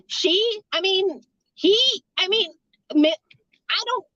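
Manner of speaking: flustered false starts with little gaps; stammering. Retold or imitated. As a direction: act out being stuck for an answer, in broken starts. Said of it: "stammering over my words".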